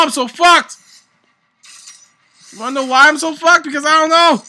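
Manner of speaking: ordinary talk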